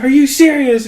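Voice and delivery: high, loud mock outrage; mock flipping out. Irate customer confronts the barista, mock flipping out. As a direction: imitate an incredulous customer blowing up. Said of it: "she starts flipping out".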